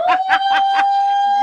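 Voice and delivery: high, loud gleeful laughter; excited laugh. Real, not acted. Laughing at her success.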